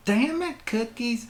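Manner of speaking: high, loud exasperated shout; angry yell. Acted. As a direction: holler after the runaway pet. Character yells at fleeing pet.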